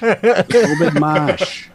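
put-on drawn-out creepy old-timer; hammy old townie; old local voice.

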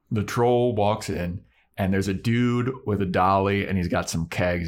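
normal talking voice

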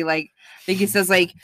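normal talking voice

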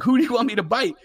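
high-pitched pleading as a baffled dog; confused dog. Retold or imitated. Dog answering its yelling handler, lightly voiced confusion. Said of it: "flanking your dog like".

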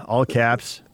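ordinary talk, nothing marked

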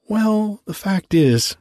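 a simple grateful little gardener; Hans, meek gardener. A narrator's reading.

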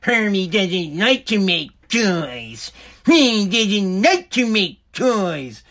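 slow, drawn-out whiny elf boss; head elf voice. Retold or imitated. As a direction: drag it out like a sulky head elf scolding Hermie. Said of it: "or I like to call it head elf".

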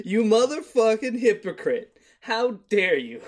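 slow, loud, high-pitched indignation; mock outrage. Podcaster imagining someone confronting Holmes over his burial, mock outrage. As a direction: scold with hammed-up, drawn-out indignation.

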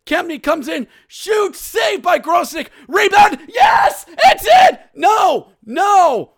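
high, loud goal call, shouting; excited play-by-play. Acted. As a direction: call the two-on-one live, erupting at the goal.

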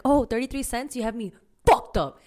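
indignant. Retold or imitated. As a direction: call out the tiny raise. Indignant retort to lowball.

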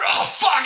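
high, loud strained howl attempt; a second, worse wolf howl. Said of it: "that was worse".

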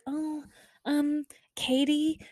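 high, drawn-out hesitant call; hesitant interrupter. Retold or imitated. Someone stopping a nurse at the station, hesitant interrupter.

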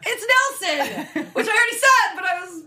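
high, laughing mock reveal; building it up. Real, not acted. Host revealing the blackmailer to co-hosts, building it up. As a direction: announce the culprit with high-pitched excitement. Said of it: "I'm building it up again".

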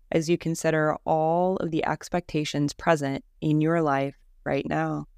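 calm, even Yoga Nidra guidance with long pauses; guided meditation. Acted. Teacher guiding listeners through Yoga Nidra practice, calm guided-meditation voice. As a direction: lead them gently into rest, leaving space after each phrase.

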